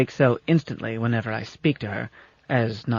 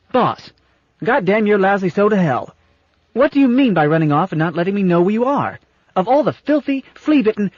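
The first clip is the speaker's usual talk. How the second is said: put-on child's angry tirade; 8 semitones higher, 6 dB louder than usual. A narrator's reading. Little-girl ship cursing her captain.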